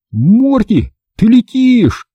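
high-pitched amazed exclamation; crying out. A narrator's reading. Boy sees his dog flying, crying out. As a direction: call out high in astonishment. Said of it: "закричал я".

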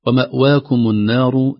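ومأواكم النار slowly, the و thin; light waw. Shown as a demonstration. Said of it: "ترقيق الواو". (ترقيق الواو في ومأواكم)

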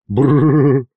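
rolled shivering brrr; mock shudder. A narrator's reading. Tsar scaring his servant, theatrical shivering brrr. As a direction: trill the lips in a play shiver.

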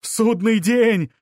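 high, drawn-out cry of doom; stretched high-pitched yell; shouting. A narrator's reading. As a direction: proclaim it slowly in a high shout. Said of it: "кричал один голос".

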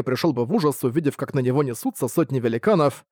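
ordinary delivery

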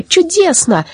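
very high delighted exclamation; gleeful cry. A narrator's reading. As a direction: cry out as if bouncing with joy. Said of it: "кричали они, подпрыгивая".

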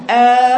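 long "آ" made thick and heavy; "آ" held heavy, demonstrated as a mistake; over-heavy hamza. Shown as a demonstration. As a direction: say "آ" with exaggerated tafkheem, a full dark vowel. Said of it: "شدة تفخيم أجي أقرأها تقول".

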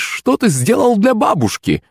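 high, drawn-out delighted granny; joyful old woman. A narrator's reading. Old woman thanking her helper, joyful. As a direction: gush with joy like a grateful granny. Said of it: "В голосе старухи появились нотки радости".

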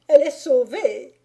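high, loud cry of relief; exclaiming. A narrator's reading. Bailiff announcing his wife is safe, excited cry. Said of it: "s'écria le bailli".

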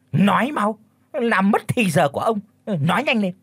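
high, curt and irritated; impatient demand. Acted. Chief snaps at Thu to speak, impatient. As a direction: hurry her up, annoyed.

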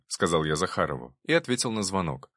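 ordinary delivery, nothing marked

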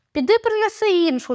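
high-pitched command; ordering. A narrator's reading. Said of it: "наказав він Джулії". (наказовим тоном)

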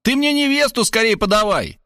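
high, insistent order; indignant high mother voice; angry demand. A narrator's reading. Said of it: "рассердилась мать".

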